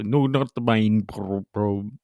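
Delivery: half-hearted bubble impression, slowed down; comic attempt at an underwater voice. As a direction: try talking like a bubble, slowly. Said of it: "trying to be as a bubble".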